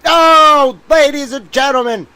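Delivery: high, loud dramatic address; theatrical soapbox cry; mock proclamation. Real, not acted. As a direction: play to the crowd.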